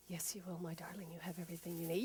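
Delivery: quiet loving inner voice; soft cheerleader voice. Acted. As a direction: comfort them gently. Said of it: "a softer, quieter voice".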